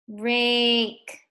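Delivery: RAKE with a held A; said slowly. Shown as a demonstration. Teacher after writing the silent E, RAKE drawn out. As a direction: stretch RAKE on a level note. Teacher repeats word, vowel stretched.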